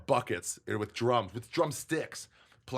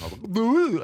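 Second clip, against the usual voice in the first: playing a hillbilly caricature; 14 semitones higher, 8 dB louder, slower than usual. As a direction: ham up a country bumpkin. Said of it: "go real caricature about it".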